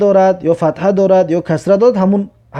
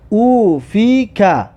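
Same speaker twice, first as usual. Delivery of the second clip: slow syllables with long held vowels; drawn-out reading; much slower than usual. Shown as a demonstration. Teacher models reading a sentence for learners, slow and drawn out. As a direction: stretch "او فی که" slowly, syllable by syllable. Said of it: "این جمله را بخوان".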